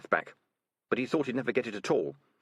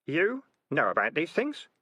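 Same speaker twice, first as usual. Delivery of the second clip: mildly amazed, higher question; 8 semitones higher than usual. Acted. Keith questioning Melissa about Rat Kings, amazed. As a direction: ask in mild disbelief. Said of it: "turned to her in amazement".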